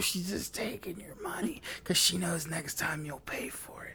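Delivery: hushed aside to a friend; whisper. Retold or imitated. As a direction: lean in and whisper it. Retold warning to friend.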